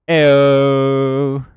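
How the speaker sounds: loud high playful ayo; excited ayo. Real, not acted. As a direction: call it out with a grin. Playful exclamation.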